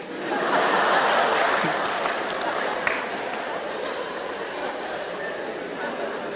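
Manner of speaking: long airy burst of laughter; laughing along with the listeners; congregation laughing. Real, not acted. Pastor laughing with the congregation at the hitman's excuse. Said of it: "y ustedes se ríen de eso".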